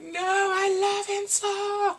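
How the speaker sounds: high, slow, loud wail; mock wailing. Viewer hears of the illness, hammed-up high wail. Viewer's over-the-top dismay.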